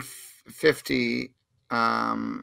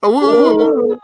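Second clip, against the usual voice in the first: high, loud wolf howl woo; 10 semitones higher, 11 dB louder than usual. Pretend. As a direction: throw your head back and howl. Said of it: "we're all gonna try to say woo woo".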